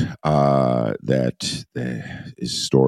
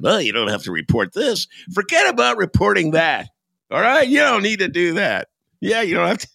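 dismissive advisor voice; 3 dB louder than usual. Retold or imitated. Voicing the bad advisor.

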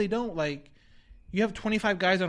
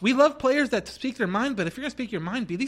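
exasperated advice; 3 semitones higher than usual. Retold or imitated. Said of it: "I'm just thinking to myself".

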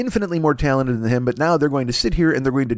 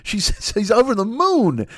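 normal talking voice

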